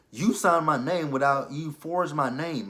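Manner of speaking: slow it down to nail him; heated accusation. Retold or imitated. Tasha confronting Ghost.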